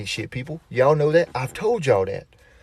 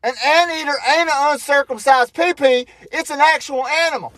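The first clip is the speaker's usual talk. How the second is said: imitated kid's yell; 12 semitones higher, 4 dB louder than usual. Son correcting dad across the store, yelling. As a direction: holler it like a kid calling across a shop. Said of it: "this boy yells across the store".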